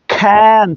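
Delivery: can with C like খ; aspirated K. Shown as a demonstration. Teacher modelling can, aspirated can. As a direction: puff of breath opening can. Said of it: "খর মতো উচ্চারণ হবে".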